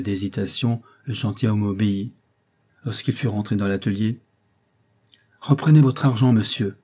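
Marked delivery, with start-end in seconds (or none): 5.4-6.8 higher, louder angry demand; choked anger. A narrator's reading. Painter angrily returns the visitor's money, choked with anger. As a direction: throw the money back at him in anger. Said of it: "d'une voix que la colère rendait à peine intelligible".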